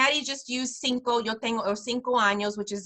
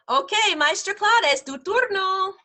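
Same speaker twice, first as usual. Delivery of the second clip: bright handover; 8 semitones higher, 4 dB louder than usual. Real, not acted. Calls fellow teacher to take turn.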